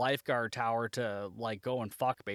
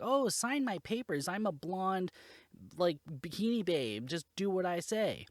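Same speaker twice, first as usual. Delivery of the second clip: higher mock ditzy blonde voice; 6 semitones higher than usual. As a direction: play up a ditzy beach blonde, pitched up. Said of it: "ditzy blonde sort of person".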